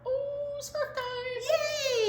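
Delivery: high, delighted cooing; thrilled for the scarf game; excited ooh. Real, not acted. Teacher reacts to scarf time, high and thrilled.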